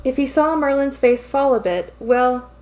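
ordinary delivery

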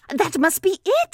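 high, loud agreement; eager Piglet. A narrator's reading. Piglet agreeing with Pooh, high and eager.

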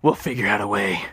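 deep voice giving quiet assurance; deep-voiced brain. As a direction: play the brain, deep and steady. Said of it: "The brain has a deep voice".

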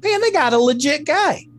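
voicing an impressed viewer, high-pitched; imagined impressed viewer. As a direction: gush like a surprised fan. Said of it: "I might have been like".

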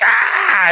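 loud high imitated yelp; mock scream. Imitating a woman in a clip, loud mock yelp. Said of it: "and she's like".

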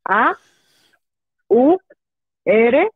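letra por letra, con pausas; deletreando. Shown as a demonstration. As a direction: deletrear despacio y claro. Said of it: "A ver, deletréalo". (deletreando su correo)

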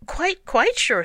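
high, loud, slow insistence; emphatic assurance. A narrator's reading. Pooh assures doubting Rabbit, emphatic and high.

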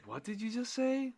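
squeaky, stunned what did you just say; shocked disbelief. Retold or imitated. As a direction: act floored by the news. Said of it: "I'm like".

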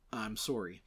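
soft, low Canadian "sore-ee"; Canadian accent. Retold or imitated. To my Canadian friends, softly imitated Canadian accent. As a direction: apologize quietly in a put-on Canadian accent. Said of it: "to my Canadian friends".

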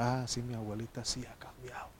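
whispered grandchild quote. Retold or imitated. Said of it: "Los nietos pueden decir".

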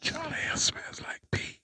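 slow sneaky whisper. Pretend. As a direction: whisper the tease slowly. Teasing daughters in whisper.

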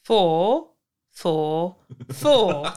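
each word said separately; word by word, deliberately apart; staccato. Shown as a demonstration. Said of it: "trying to say each word separately".

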